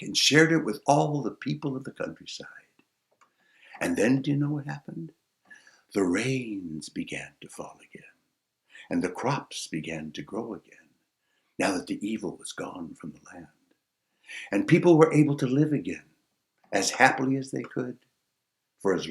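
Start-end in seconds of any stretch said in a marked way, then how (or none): 5.9-13.5 soft low storyteller hush; hushed narration. A narrator's reading. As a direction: hush the ending gently.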